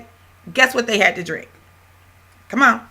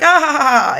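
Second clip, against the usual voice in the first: high, louder hammed-up "ha ha ha" laugh; mock laughter; 7 semitones higher, 6 dB louder than usual. Students laughing through their skit, mock laughter. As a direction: imitate a room laughing loudly.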